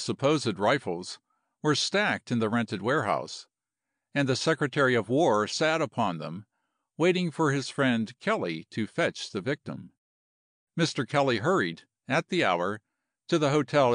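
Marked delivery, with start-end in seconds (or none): none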